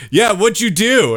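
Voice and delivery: high, slow mock accusation; accusing driver. Rear-ending driver to the man he hit, accusing. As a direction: imitate a driver shifting the blame.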